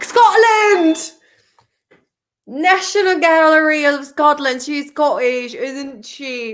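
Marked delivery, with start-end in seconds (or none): 0.0-1.0 loud, high burst on the guess; excited exclamation. Real, not acted. 2.5-4.6 loud, badly faked Scottish accent; attempted Scottish accent.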